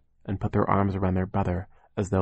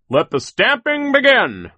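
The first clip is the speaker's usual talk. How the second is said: much higher, loud, slow muffled call; terrible muffled voice; 10 dB louder, slower than usual. A narrator's reading. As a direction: announce it like a grotesque boss. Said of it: "he said in his terrible muffled voice".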